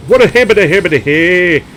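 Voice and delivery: loud, high mock-Irish nonsense; Irish accent. Player quotes his nonsense lyric, high mock-Irish babble. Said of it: "that sort of noise in an Irish accent".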